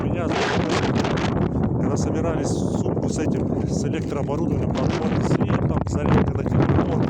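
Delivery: gusty wind roaring over the mic; talking outdoors into strong wind. Real, not acted. Storm wind while packing up.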